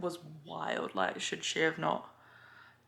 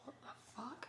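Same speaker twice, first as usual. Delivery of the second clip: breathless stunned whisper under the breath; softer whispered what the fuck; shocked whisper; 5 semitones higher, 16 dB quieter than usual. Retold or imitated. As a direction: mutter it in disbelief, barely voiced. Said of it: "I was like".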